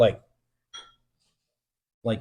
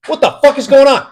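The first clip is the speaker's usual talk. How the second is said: much higher and louder, panicked; panicked yell; 12 semitones higher, 11 dB louder, faster than usual. Retold or imitated. Scared guard as the noise grows louder, panicked yell. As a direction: shout it in alarm.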